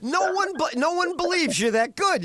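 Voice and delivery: hammed-up disbelieving club boss; mock incredulous shout. Club boss shouting at a hopeful comic, hammed-up disbelief. Quoting a doubtful club manager.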